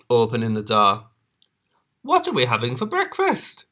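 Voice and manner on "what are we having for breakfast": very high, quavery granny; kindly old granny. Acted.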